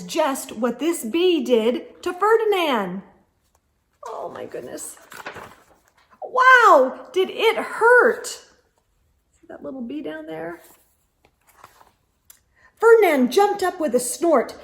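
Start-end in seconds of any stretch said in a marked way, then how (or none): 4.1-5.0 much lower, soft oh my goodness; quiet parent aside; hushed aside. Real, not acted. Aside to child. 6.2-8.3 high, loud surprised question; excited wow. Real, not acted. Excited aside to child. 8.3-10.6 hushed picture-book aside; soft aside. Real, not acted.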